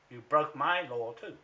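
accusing the lawyer, loudly; loud accusation. Real, not acted. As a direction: jab it at him.

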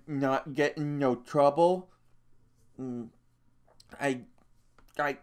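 anxious to please, tripping over words; simple-minded big man. A narrator's reading. Lennie telling Crooks why he came, nervous and halting.

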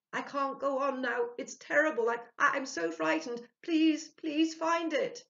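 high, loud and slow terrified plea; frightened pleading. A narrator's reading. The frightened spirit begging Ludwig, high and loud. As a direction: beg desperately in fear. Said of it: "trembling characters".

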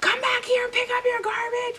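high-pitched mock yell at me; shrill it out like the angry lead chasing you; retold yell. Coworker yelling after me down the store. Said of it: "yelling, like".

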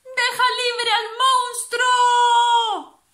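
very high, loud, drawn-out call; shouted. Acted. Little toad shouting to grandpa, high, loud and slow. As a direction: cry out to grandpa bravely.

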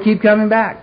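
a hint of a chirpy old-timer welcoming a newcomer; cheery newcomer greeting. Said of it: "he looked at me and said".

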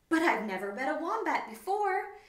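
high, loud and delighted; delighted wallaby. Acted. Wendy hops with delight at meeting a wombat. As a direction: gush with wonder.

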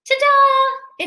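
high, loud ta-da; excited reveal. Acted. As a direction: show it off proudly. Pencil unveils drawing.

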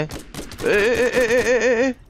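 rapid string of high "eh-eh-eh" cries; high, insistent eh-eh-eh; high-pitched "eh" repeated. Real, not acted. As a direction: squeal "eh, eh, eh" in a high voice. Reacts to the game.

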